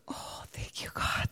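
breathy whispered thanks; grateful whisper. Retold or imitated. Karen whispers her thanks to God in relief. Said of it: "It's like".

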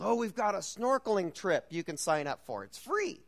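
bright sales pitch, leaping high on free; mock cheerful tout, high on free; hotel salesperson. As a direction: imitate a chirpy hotel rep selling the trip. Hotel staff pitch mimicked.

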